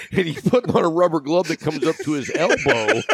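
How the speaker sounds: ordinary delivery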